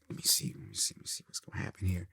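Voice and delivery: hushed, under-the-breath musing; musing to himself. Retold or imitated. Author waiting to hear back, musing under his breath. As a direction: say it almost without voice, as private thought.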